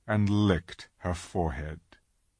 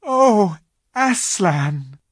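high, loud frightened joy; crying out; 11 semitones higher, 8 dB louder, much slower than usual. Acted. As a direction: gasp his name in awe. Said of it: "cried both the children".

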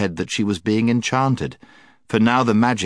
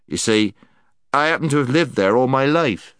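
emphatic, raised-voice insistence; Puddleglum; 6 semitones higher, 4 dB louder than usual. A narrator's reading. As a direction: insist on it stoutly.

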